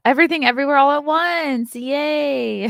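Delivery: high, loud celebratory read-out; excited cheer. Real, not acted. Reading out award winners to viewers, high excited cheer. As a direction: announce it loudly and cheer, stretching into a high yay.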